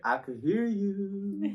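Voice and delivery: drawn-out, pointed comeback; slow sassy clapback; slow pointed retort. Retold or imitated. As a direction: lay each word out slowly, unimpressed. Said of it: "And I was like".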